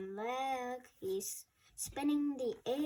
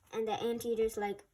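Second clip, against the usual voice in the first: ordinary delivery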